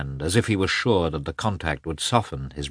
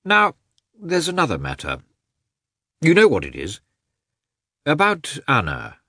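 lightly acted, higher-pitched character voice; Oblonsky; 10 semitones higher, 5 dB louder than usual. A narrator's reading. Oblonsky broaches a matter to his brother-in-law, lightly acted. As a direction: raise a new matter with emphatic openings.

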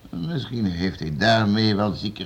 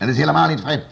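louder, higher cutting denial; sharp rebuttal; 5 semitones higher, 6 dB louder, faster than usual. Acted. Lord rejecting servant's excuse, sharp and loud. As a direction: slap down the excuse.

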